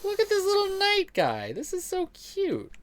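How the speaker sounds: squeaky high coo at a tiny knight. Real, not acted. Cooing high at a tiny game knight, delighted.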